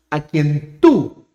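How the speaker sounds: "tú" drawn out and hit hard; slow phrase with a strong accent on "tú"; stress on TÚ. Shown as a demonstration. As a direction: land heavily on "tú", slowly. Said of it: "con harto acento".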